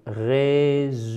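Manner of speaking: "Résumer," very slowly, syllable by syllable; drawn-out syllables. Shown as a demonstration. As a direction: stretch each syllable of "Résumer," for learners. Teacher repeats it drawn out. (« Résumer, » très lentement, syllabe par syllabe)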